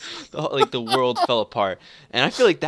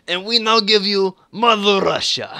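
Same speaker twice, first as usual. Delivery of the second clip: grand, slow Russian announcement; bad Russian accent; 9 semitones higher, 5 dB louder, slower than usual. Presenting Putin his prize, hammed-up Russian announcer accent. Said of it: "very bad attempt at a Russian accent".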